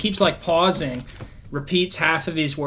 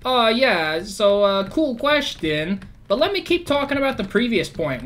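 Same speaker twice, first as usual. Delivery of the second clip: mock Trump; 7 semitones higher than usual. Mocking Trump dodging the abortion question: high hammed-up brush-off. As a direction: ham up a dismissive brush-off. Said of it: "And he's like".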